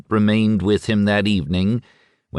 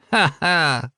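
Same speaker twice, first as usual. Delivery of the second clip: much higher, mock laugh; 10 semitones higher than usual. A narrator's reading. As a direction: scoff at him lightly. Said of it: "the kid mocked him".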